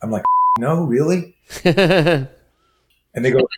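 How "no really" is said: mock disbelief. Told there is no swearing.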